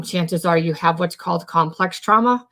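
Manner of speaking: normal talking voice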